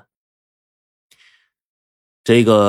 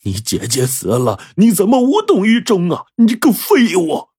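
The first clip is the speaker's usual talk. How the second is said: father bellowing at his useless son; roaring at his son; 10 semitones higher than usual. A narrator's reading. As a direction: scream the insult at the end. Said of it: "对着王茂就吼道".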